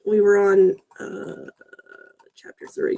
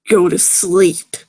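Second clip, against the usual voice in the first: very slow, louder go to sleep; slow ominous; 5 dB louder, much slower than usual. Acted. Villain line repeated with emphasis.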